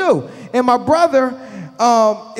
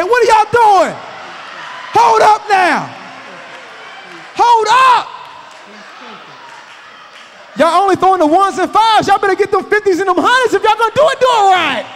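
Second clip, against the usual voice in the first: mock outraged dad; 9 semitones higher than usual. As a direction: shout at the kids, then hype them up. Father yelling at dancing daughters.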